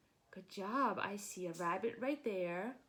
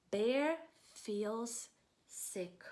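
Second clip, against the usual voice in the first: slow title read; much slower than usual. Shown as a demonstration. Teacher reads title slowly.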